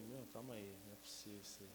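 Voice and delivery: low, dropped to near nothing in disbelief; stunned hush. Retold or imitated. Husband hearing of twins, stunned and hushed. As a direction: breathe it out, floored.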